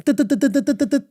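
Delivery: rapid high-pitched horn toots; staccato mimicked car horn beeps; imitated car honking. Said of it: "was honking".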